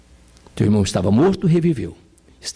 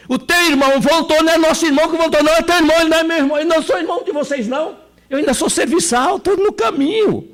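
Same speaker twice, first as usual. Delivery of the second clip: servant insisting; 13 semitones higher than usual. Acted. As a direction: press the word "teu" as a humble servant. Servant explains his place.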